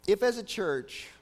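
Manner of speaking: ordinary talk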